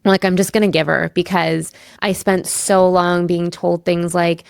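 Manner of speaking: ordinary talk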